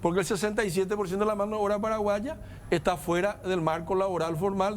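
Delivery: ordinary talk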